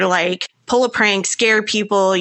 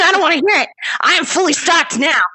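play-shout your defence, pitched up; mock angry shout; 9 semitones higher than usual. Defending her stocked cabinet to teasing friends, mock shout.

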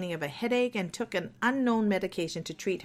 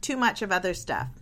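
normal talking voice

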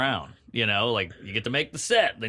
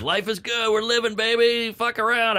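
carefree younger self; 11 semitones higher than usual. Retold or imitated. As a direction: play your dumb carefree teenage self, pitched way up. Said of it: "I think, that I was like".

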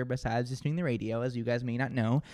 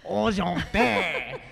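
long, drawn-out French flourish, pitched up; mock French; 4 semitones higher, 3 dB louder, much slower than usual. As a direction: overdo the French accent, very slowly. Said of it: "okay, a French dog".

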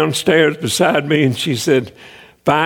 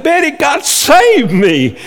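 higher and slow, full of wonder; joyful announcement; 8 semitones higher, slower than usual. Retold or imitated. Husband tells his wife God saved him, slow and amazed. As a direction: tell her slowly, amazed.

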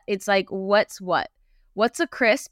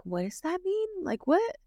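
high, softened puzzled quote; mock bafflement; 11 semitones higher, 6 dB quieter than usual. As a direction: act out baffled disbelief, pitched well up. Retelling confusion at slang.

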